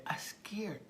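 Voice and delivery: put-on small, high child voice; timid high-pitched boy; scared little boy. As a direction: say it like a frightened small kid. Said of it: "his little face".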